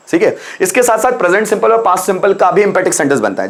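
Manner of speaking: ordinary delivery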